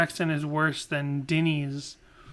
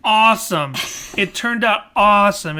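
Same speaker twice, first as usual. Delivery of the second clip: excited; 4 semitones higher, 8 dB louder than usual. Pretend. Mock-defending the band.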